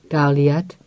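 usual speaking voice

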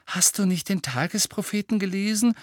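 high, anxious questioning; worried. A narrator's reading. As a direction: ask with concern, pitch climbing. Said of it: "fragte Hermine besorgt".

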